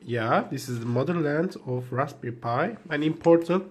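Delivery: usual speaking voice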